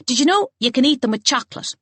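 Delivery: high, eager piping voice. A narrator's reading. Little Kieran piping up about chocolate, high and eager. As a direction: chirp it up like an eager little boy. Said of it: "Kieran piped up".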